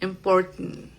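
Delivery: "important" finished softly through the nose; nasal glottal T. Shown as a demonstration. Teacher showing the nasal sound, glottal T. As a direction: stop the T of "important", let the n hum in the nose. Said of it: "como muy con la nariz".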